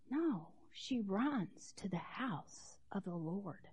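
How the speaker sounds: hushed whisper; whispered reverently. Real, not acted. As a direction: drop to a whisper for the church. Whispered in sermon.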